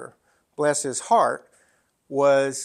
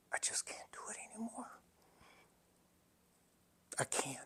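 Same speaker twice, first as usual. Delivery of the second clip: barely voiced, defeated whisper; exhausted whisper; 6 semitones higher, 19 dB quieter, faster than usual. Worn-out father whispering to his son that he can't go on. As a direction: breathe it out, all strength gone.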